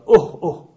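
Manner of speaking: pained cough sound. Retold or imitated. Teacher imitating a man with chest pain, mild groan.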